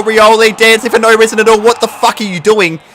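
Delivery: high, loud yell at the game; loud, high-pitched outburst; outraged shouting. Real, not acted. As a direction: shout in real disbelief.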